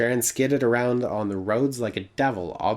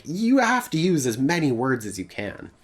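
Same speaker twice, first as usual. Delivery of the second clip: ordinary delivery, nothing marked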